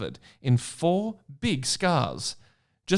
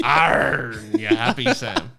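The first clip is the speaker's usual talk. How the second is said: louder pirate-English aren't; mock pirate English; 6 dB louder than usual. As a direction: growl it like a pirate. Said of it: "almost pirate English".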